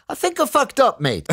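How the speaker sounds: high-pitched, imitated thick British accent. Comedian phoning late to confess, imitated thick British accent. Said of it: "with that thick British accent he has".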